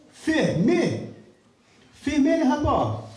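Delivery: mock panic, high; shocked. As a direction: disbelief at the closed airport. Pastor shocked airport is closed.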